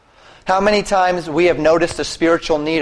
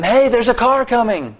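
mock warning yell; 8 semitones higher than usual. Preacher acting out yelling at a man in the road, mock warning yell. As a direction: call out a warning on a much higher pitch. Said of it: "Instead of yelling at him".

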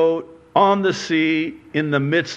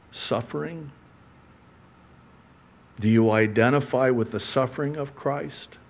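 normal talking voice

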